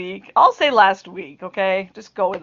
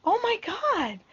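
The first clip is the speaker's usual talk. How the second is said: high-pitched, startled gasp of memory; suddenly remember the order; sudden realization; 9 semitones higher than usual. Retold or imitated. Wife remembers the gift to husband, high surprised. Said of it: "And I go".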